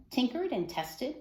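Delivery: plain reading voice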